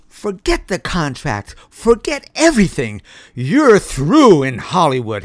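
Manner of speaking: rising, loud furious outburst; angry shriek. A narrator's reading. Director firing the dog actor in anger. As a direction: let the rage build to a shriek. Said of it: "he shrieked".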